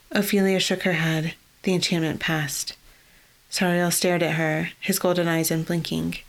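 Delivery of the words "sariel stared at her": ordinary delivery, nothing marked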